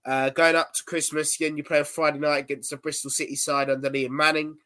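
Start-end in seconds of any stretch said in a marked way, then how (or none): none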